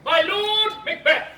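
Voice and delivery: high, loud, slow stage greeting; grand formal address. Acted. Banquo addresses his friend Macbeth, grand declaiming. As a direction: proclaim the title grandly.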